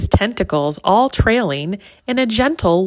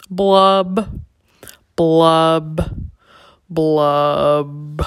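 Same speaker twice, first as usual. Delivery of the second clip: slow sulky fish blubs, each lower; glum bubble blub; 3 semitones lower, much slower than usual. Acted. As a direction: mope out three bubbly blubs. Fish glumly blowing bubbles.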